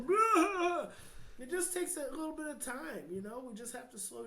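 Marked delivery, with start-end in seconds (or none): 0.1-0.9 high, loud mock wail of both friends crying; hammed-up sob of two friends breaking down; imitated shared cry. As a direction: let out a big high crying "Ah". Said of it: "and we're both".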